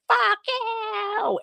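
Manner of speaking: sudden high shrieked curse; freaking out. Retold or imitated. Margaret turning on the killer, high shrieking curse. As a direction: screech it way up high, in a panic. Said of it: "just starts freaking the fuck out".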